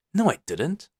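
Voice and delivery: put-on a parent bickering with his kid, a touch heated; mock squabble with a child; defensive denial. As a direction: argue back, pitch jumping up. Dad arguing with son role-play.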